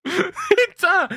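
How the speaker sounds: sudden high burst of surprise; startled swear. Real, not acted. Streamer spots baby Yoda floating by, surprised swear. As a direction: blurt it out, caught off guard.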